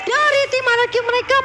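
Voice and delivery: high, rising hype call; excited play-by-play. Real, not acted. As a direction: ride the teamfight like a live caster. Caster calls a teamfight triple kill.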